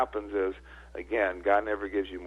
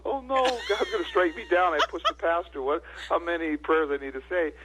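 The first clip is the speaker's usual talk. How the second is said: mock panic; 9 semitones higher than usual. Panicked inner reaction, pushed pastor.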